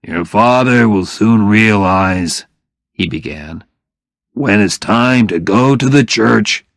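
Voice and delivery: father gently advising; lightly voiced father. Phil lecturing Oliver.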